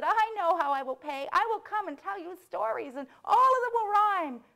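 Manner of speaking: high, loud, jubilant squeal; excited mouse. Acted. Mouse promising the boy rhyming stories, overjoyed high shouting. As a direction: burst out, climbing to a big finish. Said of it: "jumped up and down and said".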